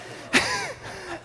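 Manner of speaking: a very high burst of laughter. Real, not acted. Laugh after story.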